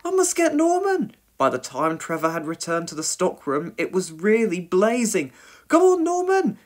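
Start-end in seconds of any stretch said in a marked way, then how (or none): 0.0-1.2 very high-pitched, urgent voice; alarmed cry. A narrator's reading. Dilys fears for Norman in the fire, alarmed cry. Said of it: "cried Dilys". 5.7-6.6 very high, loud call; urgent shout. A narrator's reading. Dilys calls Norman out of the burning shop, urgent shout. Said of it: "shouted Dilys".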